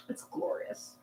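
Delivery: whispered dreamy delight; breathy whisper. Real, not acted. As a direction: breathe it out blissfully. Savoring not working at five.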